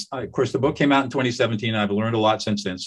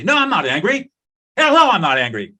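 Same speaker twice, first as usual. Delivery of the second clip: mock angry denial; 7 semitones higher, 6 dB louder than usual. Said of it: "And you'll say".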